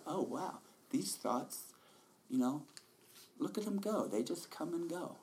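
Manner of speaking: amazed realization. Retold or imitated. Imitating wondering inner voice.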